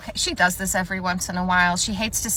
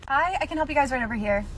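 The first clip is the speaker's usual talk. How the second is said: high-pitched polite customer greeting; chirpy greeting; 7 semitones higher, 3 dB quieter than usual. Acted. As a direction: lilt it up, quick and polite. Acted customer-service greetings at till.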